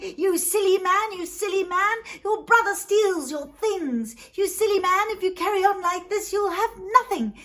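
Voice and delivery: high, loud sing-song scolding; scolding cudgels. Acted. Magic cudgels scolding the man they beat, berating. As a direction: berate him in a high, forceful voice.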